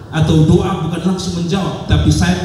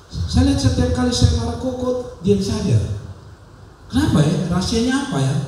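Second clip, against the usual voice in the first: Papuan manner; 8 semitones higher than usual. Retold or imitated. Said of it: "gaya Papua".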